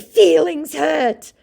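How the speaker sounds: high, slow put-on jeer; mock scammer taunt. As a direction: echo her words in a silly high voice. Voicing scammer mocking her.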